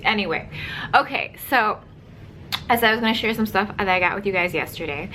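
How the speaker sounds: ordinary talk, nothing marked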